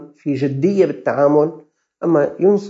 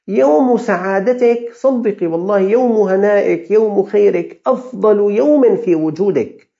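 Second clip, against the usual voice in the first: fervent uplift; 7 semitones higher, 6 dB louder, slower than usual. Acted. Consoling a woman afraid of death.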